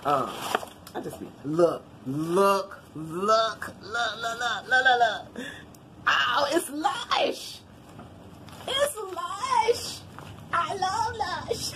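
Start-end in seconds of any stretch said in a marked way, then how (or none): 1.4-5.5 repeated word climbing steadily in pitch; building excitement. Real, not acted. Vlogger spotting Lush in the box, increasingly excited. Spots Lush among the gifts. 6.1-11.7 very high, drawn-out squeal of delight; ecstatic squeal. Real, not acted. Vlogger finding a Lush gift, ecstatic.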